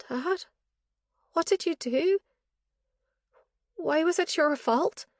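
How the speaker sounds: high, urgent plea from the daughter; daughter pleading. A narrator's reading. Daughter begging her father for answers, high and urgent. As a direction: press him for the truth, high and anxious.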